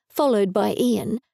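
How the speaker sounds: plain reading voice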